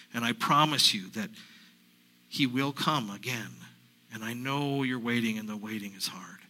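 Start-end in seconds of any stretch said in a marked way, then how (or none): 5.4-6.4 hushed, tender aside; gentle whispered sympathy; soft whisper. Acted. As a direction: whisper it to comfort them. Consoling the congregation.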